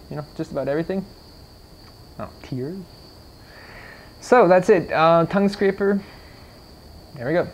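usual speaking voice

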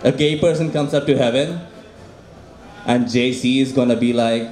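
normal talking voice

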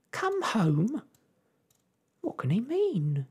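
puzzled and sharp; sharp surprise. A narrator's reading. Wife puzzling over the letter, sharp and high. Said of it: "exclaimed Homily sharply".

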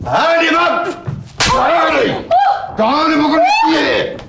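angry outburst. Acted. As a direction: flare up and order her over.

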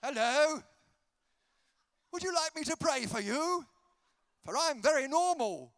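high, loud come-on to a stranger; hammed-up awkward chat-up voice; mock pickup line. Mocking an offer of prayer as chat-up, mock pickup line. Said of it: "It sounds like a pickup line".

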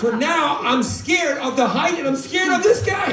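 loud, high-pitched exasperated shouting; comic panicked outburst. Acted. Comic outburst about being scared.

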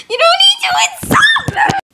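very high, loud outburst; offended shriek. Acted. Offended interviewer to Sal, shrieking.

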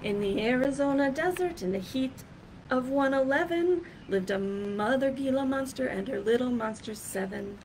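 lively, sing-song picture-book reading; reading to children. Acted. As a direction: read it out like storytime for a class.

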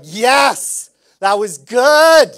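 loud, high cheer of a happy hockey fan; excited fan. Retold or imitated. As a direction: cheer it out like the team just won. Fans celebrating a Leafs win.

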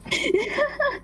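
high gloating cackle; evil laugh. Acted. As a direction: laugh in triumph at him. Babysitter locks him in.

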